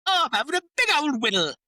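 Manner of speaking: high, loud Cockney music-hall voice; sing-song it like a cheeky Cockney; Cockney accent. Retold or imitated. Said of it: "et cetera".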